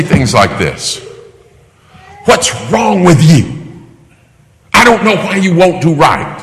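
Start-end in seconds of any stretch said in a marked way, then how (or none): 2.2-6.4 high-pitched acted parental venting; exasperated scolding. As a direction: scold the child in mock exasperation.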